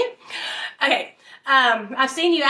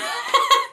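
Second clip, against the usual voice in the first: high burst of laughter; 3 dB louder than usual. Real, not acted. Laughing after the punchline.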